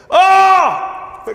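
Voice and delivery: long, high, loud mock scream; hammed-up high yell; show-off scream. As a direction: let out a big demonstration scream. Imagined showing off a dubbing scream.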